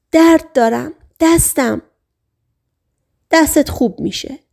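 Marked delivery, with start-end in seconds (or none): 0.1-1.8 pained cry, higher than usual; a hypnotized woman cries out about her hand. Acted. Hypnotized sailor complains of pain, pitch raised.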